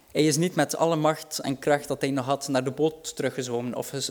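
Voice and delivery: ordinary talk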